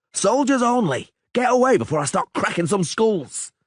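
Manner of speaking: high, loud threatening yell; angry shouting. A narrator's reading. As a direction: bark orders and threats. Soldier shouting at refugees.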